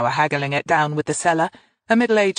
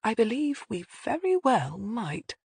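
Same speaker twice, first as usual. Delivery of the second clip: slow, higher-pitched musing; thoughtful; 6 semitones higher, 4 dB quieter than usual. A narrator's reading. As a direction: weigh it up as you speak. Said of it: "she said thoughtfully".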